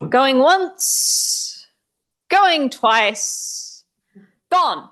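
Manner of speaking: auctioneer call. Pretend. Mock auction call to close segment.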